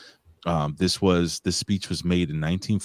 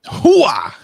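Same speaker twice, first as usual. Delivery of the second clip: loud high Pacino shout; Al Pacino hooah; 14 semitones higher, 12 dB louder than usual. Retold or imitated. Al Pacino catchphrase imitation.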